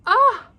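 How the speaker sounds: high, loud squeal of joy; delighted squeal. Real, not acted. Opening a gift, delighted squeal. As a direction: shriek with excitement.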